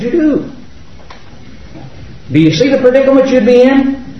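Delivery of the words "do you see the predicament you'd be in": ordinary delivery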